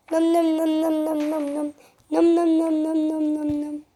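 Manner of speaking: playful nom nom chewing sounds; cartoon eating noises, fading out at the end; munching noises. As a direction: smack it up like a greedy kid eating. Kid eating the noodles.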